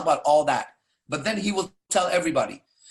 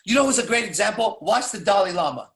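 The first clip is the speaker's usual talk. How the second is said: ordinary talk, nothing marked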